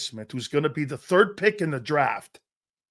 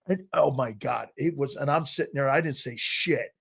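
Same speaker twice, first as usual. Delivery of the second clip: ordinary delivery, nothing marked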